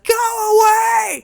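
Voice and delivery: high, loud screamed plea; retold scream. Frightened woman screaming at the banging door, high and loud. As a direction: shriek it through the door in terror. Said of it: "she screams, she's like".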